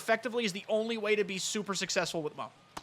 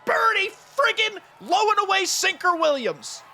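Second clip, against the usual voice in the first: drawn-out hype shout, much higher and louder; excited hype; 11 semitones higher, 7 dB louder, slower than usual. Real, not acted. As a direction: shout his full name like an announcer. Excited hype over a big play.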